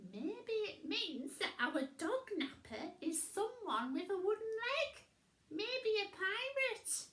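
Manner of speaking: high witch voice, guessing; a high, eager witch guess; high witch voice, quickening; Winnie voice. A narrator's reading.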